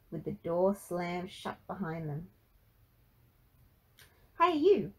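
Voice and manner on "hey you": high, loud attention-getter; calling out. Acted. Narrator hooks listeners for next book, calling out. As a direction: hail the listener with a punchy call.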